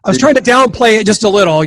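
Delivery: host amused after the guest tops him; laughing. Real, not acted. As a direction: laugh it off after being caught out.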